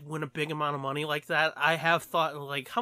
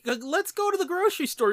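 mock wife voice; 13 semitones higher than usual. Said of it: "If the wife's like".

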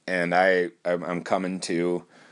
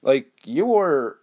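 higher-pitched, baffled patient's question; acted-out puzzlement, pitched up; puzzled questioning; 6 semitones higher than usual. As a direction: retell it as a bewildered patient quizzing the doctor. Acts out his puzzled question to surgeon.

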